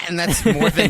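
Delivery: ordinary delivery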